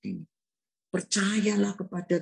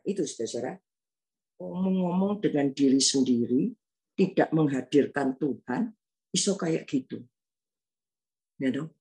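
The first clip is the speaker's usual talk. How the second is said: ordinary talk, nothing marked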